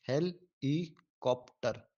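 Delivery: हेलीकॉप्टर spaced out slowly, "hel / i / kop / ter"; syllable division. Shown as a demonstration. Teacher making students remember it, हेलीकॉप्टर slowly syllable by syllable. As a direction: repeat हेलीकॉप्टर in four clearly parted syllables. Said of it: "ये इसकी correct है".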